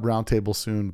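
normal talking voice

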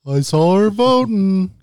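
slow, loud, high-pitched impersonation; Josh impression. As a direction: draw it out high and loud, mimicking him. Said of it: "Uh-oh, Josh impression".

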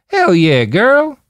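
loud, high, hammed-up Southern twang; exaggerated southern twang. A narrator's reading. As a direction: whoop it in a Southern drawl. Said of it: "with an exaggerated southern twang".

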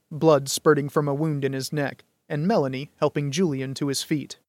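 ordinary delivery

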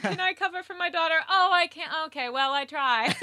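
high, loud, hurried mock pleading; hammed-up asking, then giving up at once; mock token plea. Mother asking cop to cover for daughter, hammed-up token plea.

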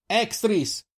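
high sharp warning cry; alarm shout. Acted. The lookout shouts a warning, high alarm cry.

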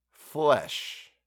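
a drawn-out, savouring "Flesh"; slow relish. A narrator's reading. Boy answers Van Cheele, savouring the word slowly. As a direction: stretch "Flesh" slowly, as if tasting it. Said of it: "pronounced the word with slow relish as though he were tasting it".

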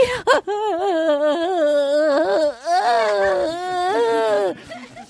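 high, long fake sob; drawn-out hammed-up wail; mock weeping. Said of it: "she's weeping".

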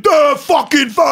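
mock yell of a loud friend. Quoting his loud friend Drago, a mock shout. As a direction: bark it like a rowdy buddy. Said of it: "he's like".

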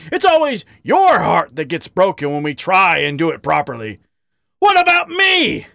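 heated rant rising to a high shout; bellowing. A narrator's reading. Badger accusing his lover of self-pity, bellowing. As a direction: build from angry complaint to a bellow. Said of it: "Harry bellowed".

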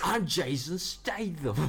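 slow, drawn-out mock London tough guy; Jason Statham impression. Co-host showing off a Statham accent, slow and hammed-up. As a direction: ham up a Cockney action star with a laugh. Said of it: "I thought that's a Jason Statham accent".

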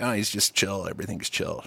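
low, very soft and unbothered; outwardly chill. Retold or imitated. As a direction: play it cool, barely raising the voice. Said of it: "on the outside".

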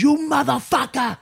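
high-pitched furious shout; snapping in rage. Retold or imitated. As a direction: act out a mobster's explosive threat. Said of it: "he just fucking snaps".

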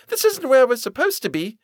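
high-pitched sulky complaint; petulant. A narrator's reading. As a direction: protest like a stubborn girl. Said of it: "said Violet petulantly".

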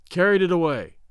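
usual speaking voice

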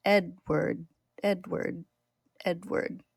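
soft, fading chimera chant; haunting creature whisper-like repetition; slow eerie repeating. A narrator's reading. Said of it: "begins slowly repeating his name".

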